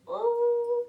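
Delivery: sharp high wince of a cry; a loud squeal of remembered horror; pained ooh. Retold or imitated. Recalling her brother's fingers in the door, a loud wince.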